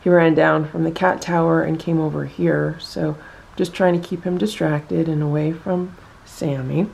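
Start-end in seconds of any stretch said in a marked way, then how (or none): none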